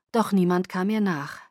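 ordinary delivery

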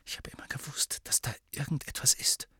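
unvoiced wondering whisper; boy whispering to himself in awe. A narrator's reading. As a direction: whisper it to your trembling fingers. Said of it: "flüsterte er".

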